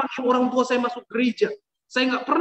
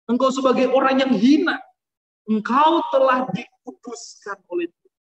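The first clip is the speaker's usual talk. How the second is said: louder, ringing proclamation to the church; fiery preaching; 3 dB louder than usual. Acted. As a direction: preach it with full force, calling them out. Preaching to the congregation. (khotbah berapi-api dan lantang)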